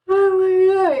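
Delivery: gasp, then high, loud held-breath outburst; trying not to cry. Real, not acted. Overwhelmed streamer moved by a friend's gift, fighting real tears. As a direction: hold your breath against tears, pitch jumping up. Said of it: "holding my breath 'cause I'm trying to not cry".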